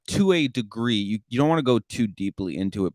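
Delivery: usual speaking voice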